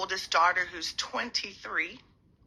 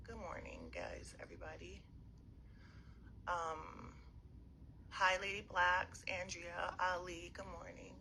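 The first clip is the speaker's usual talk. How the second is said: hushed greeting; 12 dB quieter than usual. Real, not acted. Greets viewers while the kids get ready.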